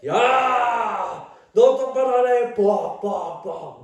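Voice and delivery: loud, high furious scolding, fading on the hits; dad yelling during worship; angry dad yelling. Retold or imitated. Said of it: "막 때리면서 막 그래". (화난 아빠가 소리치듯)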